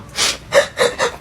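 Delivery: soft, breathy secret snicker; sly snicker. Acted. Cousin laughing quietly at the trick, soft snicker. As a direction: snicker to yourself.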